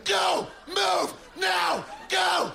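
high, shouted drill sergeant orders; barked orders. Retold or imitated. Dad waking at the alarm, shouting high orders to move. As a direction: bark rapid commands like under fire. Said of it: "he just goes".

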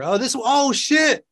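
sudden high surprised outburst. Real, not acted. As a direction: react to the hollow snack, startled. Finds hollow snack.

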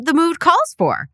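normal talking voice

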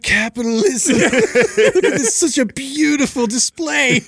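high, drawn-out gushing voice, laughing through it; mocking impression. Picturing a friend on a mall bench, high gushing mock voice. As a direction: marvel over the mall like a starry-eyed fool. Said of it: "I just picture Sal on a bench".

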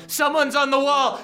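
P=A; alarm shout. Acted. Guard repeats alarm.

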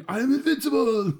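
high, loud, laughing triumphant cry; GoldenEye villain quote. Retold or imitated. Fan quoting a GoldenEye villain, loud laughing triumph. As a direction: crow it like a gleeful villain. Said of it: "GoldenEye quote".

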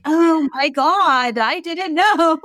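high, very slow stunned voice; an exaggerated gasping realization; mock amazement. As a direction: stretch it out in hammed-up wonder. Retelling own surprised reply.

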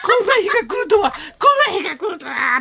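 high mock-emotional cry, stretched out; hammed-up overwrought wail; overwhelmed taxi driver. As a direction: imitate a driver overcome at meeting his idol.

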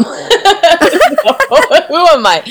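laughing through the words; giggly, amused question. Real, not acted. Laughs at her own answer.

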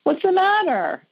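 high-pitched, tender concern; sympathetic. Real, not acted. Psychic host consoling an upset caller, sympathetic. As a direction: coo with sympathy, voice lifting high.

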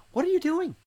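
high, squeaky disbelieving voice; incredulous mock question. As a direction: ask it in comic disbelief. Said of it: "we look at them and go".

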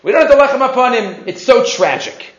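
ordinary delivery

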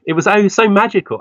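ordinary talk, nothing marked

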